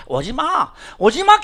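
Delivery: loud, high brush-off; shooing off. Retold or imitated. The pastor shoos off the follower, loud. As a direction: wave them away, loud. Acted quote brushing someone off.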